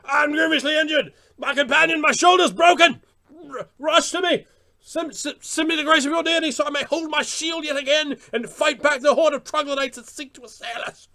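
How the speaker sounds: loud, high-pitched theatrical plea; hammed-up fantasy role-play at full volume; melodramatic wounded hero. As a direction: cry out like a fallen knight in battle. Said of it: "that was quite melodramatic".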